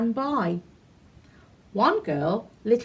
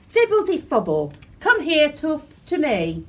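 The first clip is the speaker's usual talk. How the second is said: chanted magic incantation, louder; casting a spell; 6 semitones higher, 4 dB louder than usual. Acted. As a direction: summon the tooth with a spell. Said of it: "I'll say a quick spell".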